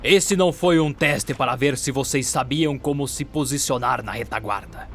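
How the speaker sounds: commanding mentor scolding recruits; stern master. Acted. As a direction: lay out the failure like a strict drill master.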